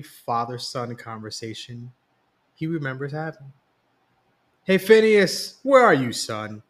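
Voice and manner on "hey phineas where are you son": loud, high call across the house; impatient yell. A narrator's reading. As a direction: yell for his son, out of patience. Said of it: "yelled Brandon's father impatiently".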